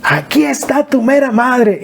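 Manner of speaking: high, slow, far-carrying call; mother's greeting shout. Retold or imitated. Mother greeting her son home from the porch, high slow call. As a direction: belt it out like mom welcoming him home. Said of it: "escuchar la voz de mi mamá, de gritar".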